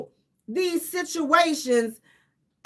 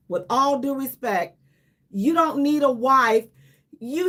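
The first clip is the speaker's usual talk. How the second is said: usual speaking voice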